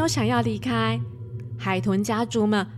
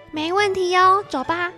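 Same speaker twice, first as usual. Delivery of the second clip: put-on cheerful little girl; 7 semitones higher, 5 dB louder than usual. Child voice replying.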